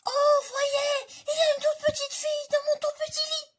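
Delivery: very high, very loud squeaky cub cry; tiny bear crying out at the girl in his bed. Acted. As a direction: shriek it like an excited tiny bear. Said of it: "cria de sa toute petite voix".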